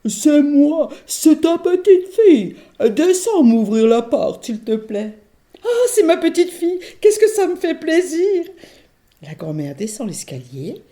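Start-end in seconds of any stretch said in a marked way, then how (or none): 0.1-5.2 much higher, louder, slow put-on girl voice; faked little girl. L'ogresse imite la fillette. 5.6-8.4 put-on much higher, louder old lady; overjoyed granny; delighted granny. La grand-mère se réjouit.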